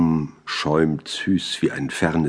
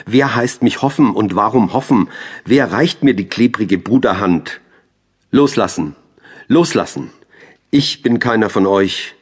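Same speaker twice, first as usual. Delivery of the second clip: loud, raised, declaimed challenge; defiant outburst; 4 semitones higher, 5 dB louder than usual. Acted. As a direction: throw it out loudly, pushing them away. Poem's outburst rejecting the brotherly hand. (laut und trotzig deklamiert)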